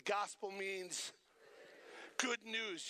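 slow, deliberate phrasing; slow call-and-response. Shown as a demonstration. Preacher leading the congregation in a slow echo, slow deliberate. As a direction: give the crowd time to echo. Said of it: "Will you say that with me?".

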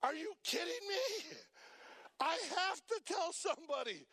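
as if the news is too good to keep; incredulous. Retold or imitated. Healed man reacting to Jesus's order, incredulous outburst. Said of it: "he had to be thinking".

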